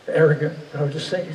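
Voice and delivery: ordinary delivery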